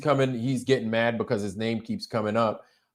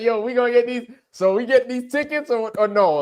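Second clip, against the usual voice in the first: much higher, pressing for an answer about the tickets; eager demand; 11 semitones higher than usual. Retold or imitated. Host retelling how he demanded the tickets, high and pushy. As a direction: push him hard, high and eager. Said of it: "I was like".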